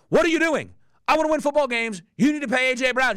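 mock the coach pleading loudly to win; frustrated coach.

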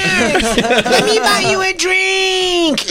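squeaky high-pitched valley girl impression; shrill fake girly gushing; mocking high girly voice. Parodying the girl meeting Aziz in a high squeal. Imitating woman voice continues.

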